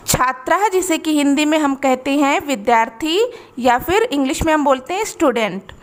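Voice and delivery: normal talking voice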